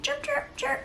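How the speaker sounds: high chirping bird calls; bird chirps. Pretend. Imitating birds, high and chirpy. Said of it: "they're always like".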